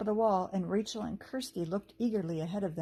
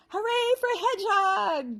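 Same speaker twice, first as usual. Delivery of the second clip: high, loud, slow cheer; shouted; 13 semitones higher, 7 dB louder than usual. A narrator's reading. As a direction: cheer it out with joy. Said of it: "the girls shouted".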